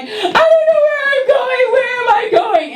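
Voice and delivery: put-on very high, panicked kid wail; lost and frantic in the station. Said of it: "you're like".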